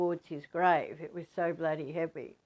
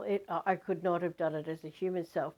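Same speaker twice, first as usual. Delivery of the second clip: ordinary delivery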